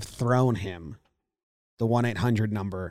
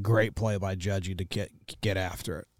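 imitating Boone; 4 semitones lower, 6 dB quieter than usual. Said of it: "and he said".

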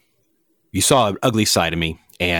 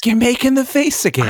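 pitched way up, accusing; high-pitched accusation. Acted. To Gray about his face, a high, accusing squeak. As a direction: squeak it out like you've been caught.

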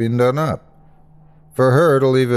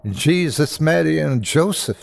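put-on higher Irish-accented oath; shocked Irish exclamation; 7 semitones higher than usual. A narrator's reading. Nurse crosses herself in fright, shocked Irish accent. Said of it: "she breathed".

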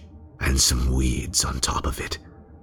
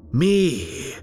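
Me flung up very high in mock disbelief; shrill mocking echo; 14 semitones higher than usual. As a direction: echo Me much higher, scoffing at the idea. Killer mocks the very idea.

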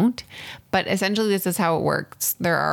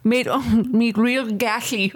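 higher, slow, slurred denture-wearer; falling-out dentures voice; 6 semitones higher, 4 dB louder, slower than usual. Pretend. Co-host trying the requested accent, loose-dentures voice. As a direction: mouth the words round slipping false teeth. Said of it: "dentures that are falling out of your mouth".

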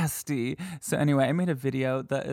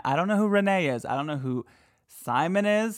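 ordinary delivery

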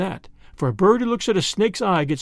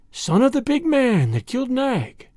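higher, menacing cobra voice; lightly hissed threat on a raised pitch; hissing snake; 6 semitones higher than usual. A narrator's reading. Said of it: "she hissed".

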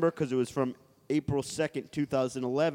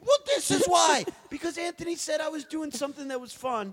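mock outrage; 11 semitones higher than usual. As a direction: protest in fake outrage. Mock angry rant at cohost.

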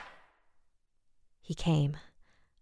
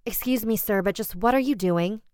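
higher-pitched, indignant challenge; crisp demand; 6 semitones higher, 3 dB louder than usual. A narrator's reading. Homeowner confronts the vagrant on the doorstep, crisp demand. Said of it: "I demanded crisply".

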